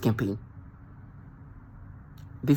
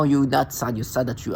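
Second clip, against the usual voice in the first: usual speaking voice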